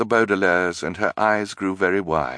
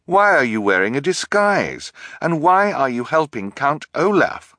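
demanding; 8 semitones higher, 5 dB louder than usual. A narrator's reading. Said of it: "Klaus asked".

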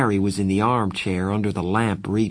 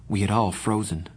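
ordinary delivery

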